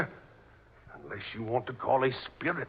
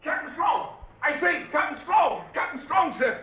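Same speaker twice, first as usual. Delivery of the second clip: much higher, calling out across the deck; urgent calling; 11 semitones higher than usual. Acted. Sailor rushing on deck to fetch the captain, urgent calls. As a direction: call him as you run up.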